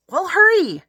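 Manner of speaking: impatient. A narrator's reading. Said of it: "I said".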